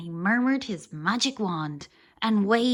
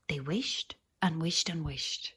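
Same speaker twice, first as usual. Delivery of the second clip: ordinary delivery, nothing marked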